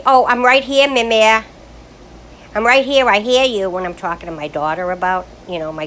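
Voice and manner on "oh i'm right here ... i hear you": loud, raised protest; indignant grandkids. Retold or imitated. Grandkids protesting to grandma, loudly indignant. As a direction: grandkids insisting they're right there. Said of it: "they say".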